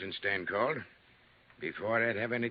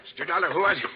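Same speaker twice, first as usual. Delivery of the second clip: louder and higher, shaken call; alarmed; 7 semitones higher, 8 dB louder, faster than usual. Acted. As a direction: demand answers right after the gunshots. Alarmed after the shots.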